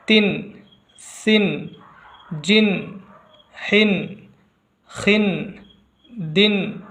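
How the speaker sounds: isolated syllables, each ending on noon; rawan reading drill. Shown as a demonstration. Qaida teacher reading two zer on sight, rawan drill. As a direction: recite one whole syllable, pause, then the next. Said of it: "चलिए इसे भी पढ़ते हैं".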